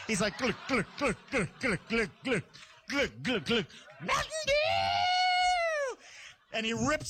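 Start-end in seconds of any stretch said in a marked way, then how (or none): 0.4-3.7 rhythmic gulping noises; comic repeated swallowing sounds; imitated chugging. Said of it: "taking it to the head like a rapper". 4.0-6.0 high, very drawn-out scream of delight; hopped-up yell. Retold or imitated. Brother shouting after chugging soda.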